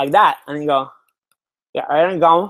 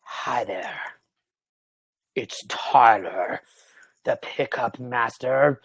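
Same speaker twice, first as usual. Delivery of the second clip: slow, low, whispered creepy host; hushed, drawn-out mock guru introduction; mock creepy intro; 6 semitones lower, 5 dB quieter, much slower than usual. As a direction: breathe it out like a cheesy pickup guru. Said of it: "come on the cell conference with you and be like".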